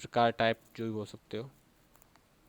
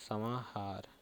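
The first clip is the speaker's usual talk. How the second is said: समाहार repeated much softer, almost to oneself; 3 semitones lower, 11 dB quieter, much slower than usual. Shown as a demonstration. Teacher repeating the term softly for dictation, quiet. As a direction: echo समाहार quietly under the breath. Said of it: "इसको बोलते हैं ऐसे".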